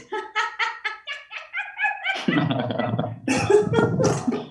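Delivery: high, yappy burst of laughter; long, high, yipping fake laugh; yapping dog laugh. Shown as a demonstration. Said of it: "we have the yapping dog".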